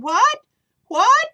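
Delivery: very high, loud rising what; startled, turning round to the call; surprised what. Acted. Lady in the yard startled by kids, high loud what.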